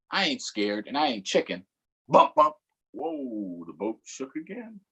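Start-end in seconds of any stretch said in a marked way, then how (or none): 2.1-2.6 high, loud bump bump; a jolting knock imitated in a high voice; bump sound. Boat bumped by something.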